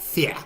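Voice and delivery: "Fear" said as "fee-ah"; muddled fake accent. Shown as a demonstration. Host repeats "Fear" as "fee-ah", in muddled fake accent. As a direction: drop the r in "Fear", mock British. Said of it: "I don't know what accent that is".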